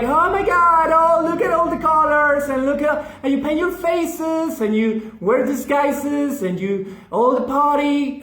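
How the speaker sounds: high, gushing mock tourist squeal. Mocking tourists to the crowd, high gushing squeal. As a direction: gush over the colors like a giddy tourist.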